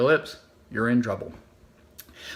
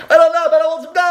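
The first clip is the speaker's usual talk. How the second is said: whiny tantrum; 10 semitones higher, 9 dB louder, faster than usual. Acted. Child throwing a tantrum at the register, whining shout. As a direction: wail it like a toddler throwing a fit. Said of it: "sounded like this".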